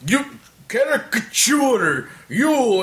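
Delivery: high, drawn-out mock broken English; big customer imitation. Drunk big customer addressing the caricature artist, imitated high, slow halting words. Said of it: "And he's like".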